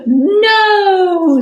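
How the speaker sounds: high shouted no; loud cry. Acted. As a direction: belt out a big no. Reading aloud, monsters refuse.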